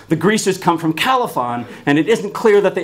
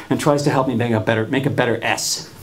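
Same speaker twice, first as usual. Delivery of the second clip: ordinary talk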